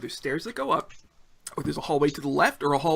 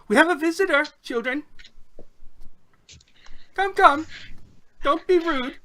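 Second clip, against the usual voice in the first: put-on high, loud old lady calling the children inside; 11 semitones higher, 3 dB louder than usual. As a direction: call them in like a kindly old lady, pitched high. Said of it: "she calls inside".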